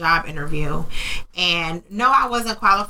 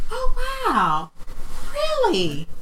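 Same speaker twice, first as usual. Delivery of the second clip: much higher, put-on fake amazement; mock surprised networker; 6 semitones higher than usual. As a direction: squeal it like a condescending acquaintance. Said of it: "they're like".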